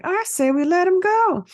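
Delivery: very high disguised squeak; put-on voice pretending to come from someone else; thrown voice. Captive slipping in his own vote, thrown voice. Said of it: "when he tries to throw his voice".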